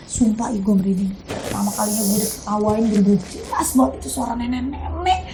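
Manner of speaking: quiet creeped-out recollection; hushed shiver. Real, not acted. Recalling goosebumps.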